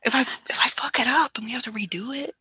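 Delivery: anxious near-whisper; nervous whisper. Real, not acted. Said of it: "I'm so nervous right now".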